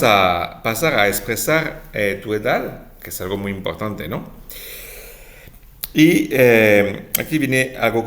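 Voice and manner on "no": ordinary talk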